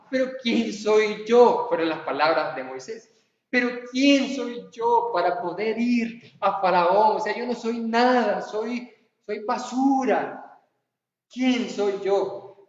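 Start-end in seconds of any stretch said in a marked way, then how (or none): 0.1-1.7 slow, lightly voiced plea of self-doubt; a touch of Moses protesting. Preacher acts out Moses doubting. 3.5-12.7 slow, drawn-out lament of worthlessness; a hint of whining Moses; self-pitying Moses. Moses protesting to God.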